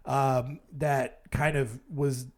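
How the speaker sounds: normal talking voice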